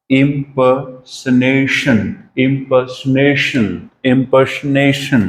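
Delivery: impersonation very slow, stress on the accented syllable; stressed pronunciation. Shown as a demonstration. Teacher showing students how impersonation is said, very slow with stress. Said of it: "उस word को थोड़ा stress करके बोलना है".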